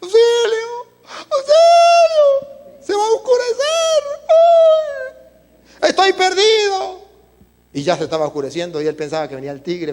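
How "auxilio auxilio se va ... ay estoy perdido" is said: high, loud, crying shouts of a lost man; panicked cries for help. Acted. Lost man in the mountains crying out for help, high panicked shouts. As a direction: wail for help in terror as night falls. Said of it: "comenzó a gritar".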